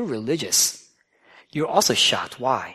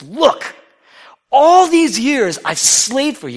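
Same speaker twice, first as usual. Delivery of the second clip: loud, slow bitter complaint; resentful son; 10 semitones higher, 7 dB louder, slower than usual. Acted. Elder son complains to his father, loud and bitter. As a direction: spit out the grievance.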